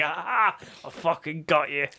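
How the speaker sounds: playful pounce on a friend; mock gotcha. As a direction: pounce with a teasing 'gotcha'. Acts out jumping on each other.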